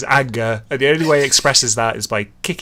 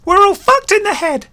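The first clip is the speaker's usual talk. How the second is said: higher, louder character voice; story character's line; 5 dB louder than usual. Acted. Character sums up for the gang, high loud voice. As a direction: pitch it up high and project the line.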